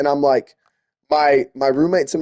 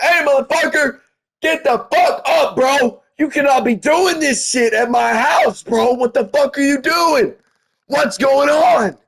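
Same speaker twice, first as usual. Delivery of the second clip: angry panicked yell; 10 semitones higher than usual. Retold or imitated. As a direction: shout him back onto his feet, furious and scared. Yelling at friend coming to.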